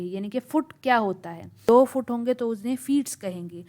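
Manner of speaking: ordinary talk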